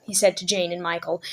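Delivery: ordinary delivery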